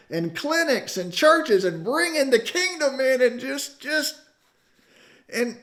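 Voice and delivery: high, loud, rising indignant preaching; retold grievance preached at full force; impassioned complaint. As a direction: pour out the injustice, building to a cry.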